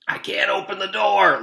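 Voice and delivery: breathless, loud and high under the weight; straining under the heavy box, gasping. Acted. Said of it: "Louis gasped".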